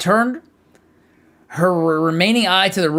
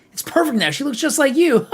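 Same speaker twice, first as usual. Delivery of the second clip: gleeful creepy voice; 11 semitones higher, 4 dB louder than usual. Acted. Gleeful apothecary.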